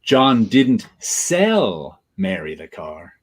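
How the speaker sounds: "sell" lifted and drawn out, then a pause; slow read with a long emphatic "sell"; stress on SELL. Shown as a demonstration. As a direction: lean hard on "sell". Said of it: "you put the emphasis on each".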